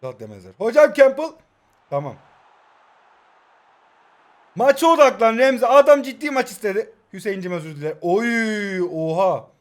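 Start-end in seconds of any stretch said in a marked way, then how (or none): 0.6-1.3 loud, high call to a teammate; urgent call-out. Real, not acted. Player calls out Campbell mid-match, urgent. 4.6-6.9 raised, higher-pitched order to focus; loud self-urging. Real, not acted. Player orders himself to focus on the match, urgent.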